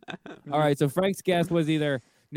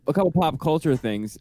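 ordinary talk, nothing marked

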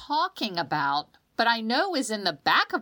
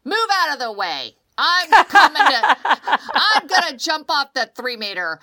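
put-on louder, higher, eager daredevil child; bold kid; 6 semitones higher, 9 dB louder than usual. Fearless kid pushing to the diving board, loud and eager. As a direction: charge past everyone to the board. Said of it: "you are the little child that just".

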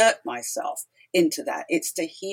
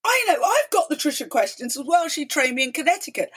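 eager reply; 7 semitones higher than usual. Retold or imitated. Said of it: "Alison DeFranco says".

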